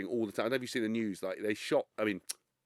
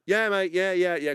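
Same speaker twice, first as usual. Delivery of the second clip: higher, louder fake-cheery agreement; forced friendliness; 5 semitones higher, 5 dB louder than usual. Celebrity humouring an annoying photographer, fake cheery. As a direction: hide the irritation behind a bright yeah mate.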